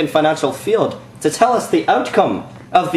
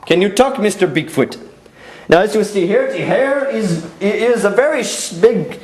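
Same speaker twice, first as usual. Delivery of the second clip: put-on earnest, lilting Indian-accented reporter; Indian news reporter. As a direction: play an upbeat field reporter from India. Indian reporter interviews Bigfoot.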